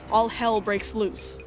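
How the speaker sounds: usual speaking voice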